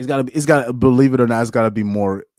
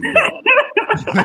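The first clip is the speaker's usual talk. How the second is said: mock yo; 4 dB louder than usual. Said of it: "You're just like".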